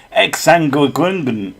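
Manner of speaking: "Exsanguingun" drawn out as a garbled word; mock mispronunciation. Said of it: "trying to pronounce it properly at you".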